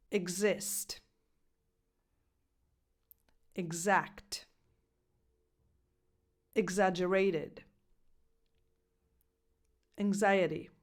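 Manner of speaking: Exist, Exact, Exaggerated, Anxiety with a voiced gz or ngz. Shown as a demonstration. Coach reads gz example words one by one. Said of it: "The second sound it can have is this one".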